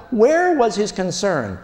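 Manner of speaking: usual speaking voice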